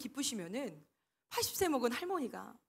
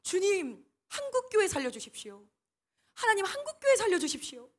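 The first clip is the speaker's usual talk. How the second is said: raised, pleading cry to God, higher and louder; fervent prayer; 8 semitones higher, 7 dB louder than usual. Retold or imitated. Mother praying under bombs, loud pleading cry. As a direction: beg God loudly as bombs fall. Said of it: "막 기도하셨대요".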